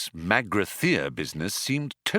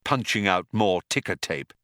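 plain reading voice

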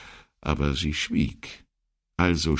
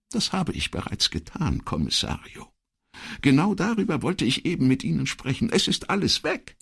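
high-pitched, urgent female character voice; agitated secretary; 13 semitones higher than usual. Acted. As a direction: report the disaster in a much higher voice. Voices Elettra in dialogue.

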